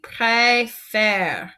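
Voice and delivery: Préfère said slowly, voice rising on the first syllable, then falling; careful demo of Préfère with the pitch going up, then down; slow model pronunciation. Shown as a demonstration. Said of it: "Say that one with me".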